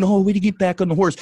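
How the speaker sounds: high, rushed mocking quote; sarcastic quick cheer; mock encouragement. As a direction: parody an eager supporter.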